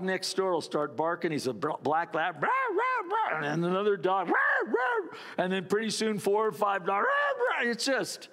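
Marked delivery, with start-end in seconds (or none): 2.4-3.5 high repeated mock barks; imitated dog barking. 4.3-5.2 high answering mock barks; high, yelped dog imitation; imitated dog barking. Imitating another dog joining in. 7.1-7.7 high mock pack barking; high, frantic dog imitation; imitated dog barking. Imitating several dogs barking.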